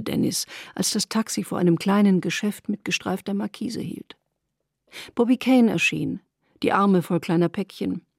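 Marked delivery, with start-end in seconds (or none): none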